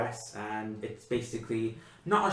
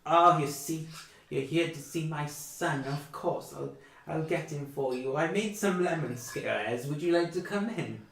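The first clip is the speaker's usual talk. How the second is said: put-on high, kindly old mother's voice; a hint of a chatty elderly mother; old woman; 7 semitones higher than usual. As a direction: fuss over the guests like a doting granny.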